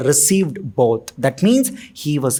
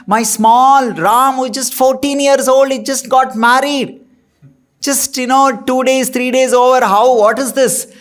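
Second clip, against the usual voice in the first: higher, pleading voice of a worried father; 6 semitones higher than usual. Retold or imitated. Dasharath pleads with Parashuram for his son, pleading. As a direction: beg for the young son, voice lifted. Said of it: "he was requesting, he was pleading".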